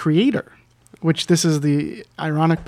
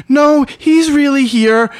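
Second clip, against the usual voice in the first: high, loud, slow insistence; imitated caller, emphatic and raised; urgent insistence; 10 semitones higher, 9 dB louder, slower than usual. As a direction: stretch it out to convince a doubter. Retold insistent phone caller.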